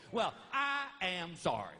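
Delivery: ordinary delivery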